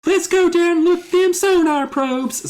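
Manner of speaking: very high, put-on cartoonish voice; excitable high character voice, loud at the peaks; Alex. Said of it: "What's the voice we gave him?".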